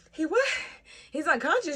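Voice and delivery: high, loud incredulous questions; retold shocked disbelief. Mother hears her son passed out, high shocked disbelief. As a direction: re-enact shock, pitch leaping up.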